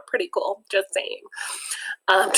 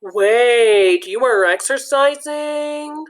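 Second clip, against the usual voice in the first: higher, slow incredulous question; surprised host; 4 semitones higher, 5 dB louder, much slower than usual. Acted. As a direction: act amazed, drawing it out. Host reacts to the glob.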